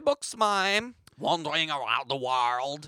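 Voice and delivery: put-on French-accented mime. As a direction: play a wandering French mime. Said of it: "my French accent isn't that good".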